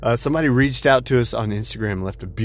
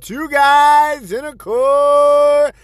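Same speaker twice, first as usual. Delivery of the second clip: booming ringmaster announcement, high and slow; 12 semitones higher, 5 dB louder, much slower than usual. Acted. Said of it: "I like to put that little dramatic pause there".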